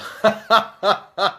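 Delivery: amused chuckle in separate bursts; laughing. Real, not acted. Presenter amused by the autograph joke, laughing. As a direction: laugh at your own gag.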